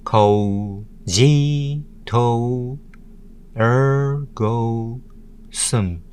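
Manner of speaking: "Cogito ergo sum" split into single syllables with pauses; "Cogito ergo sum" counted out syllable by syllable. Shown as a demonstration. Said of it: "six syllables".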